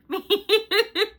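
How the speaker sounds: high, loud startled laugh; burst of laughter. Real, not acted. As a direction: laugh it off loudly. Laughing after shock.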